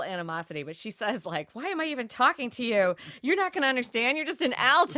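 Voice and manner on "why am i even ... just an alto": high, loud put-down; mock haughty soprano. Soprano belittling a lowly alto, mock haughty. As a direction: play a soprano looking down on an alto.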